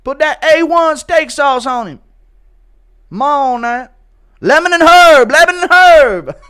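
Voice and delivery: high, loud hyped cornerman shout; mock cornerman yell. Cornerman shouting instructions to his fighter.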